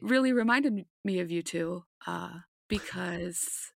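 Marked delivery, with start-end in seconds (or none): none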